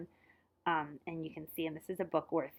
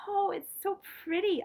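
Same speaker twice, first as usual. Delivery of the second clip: higher, slow, awed delight; delighted cry; 12 semitones higher, 4 dB louder, slower than usual. A narrator's reading. Luca seeing the quilt from Tutu, delighted and awed. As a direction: gasp it out in wonder. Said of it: "I cried".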